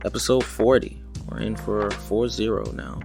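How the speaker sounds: ordinary talk, nothing marked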